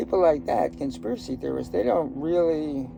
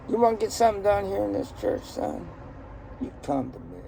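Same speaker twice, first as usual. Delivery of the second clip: put-on Southern church boss, starting high; Southern accent; 6 semitones higher, faster than usual. Church boss advising a newcomer, Southern accent. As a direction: play a Southern big shot laying down who runs things. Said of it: "these Nazis had Southern accents".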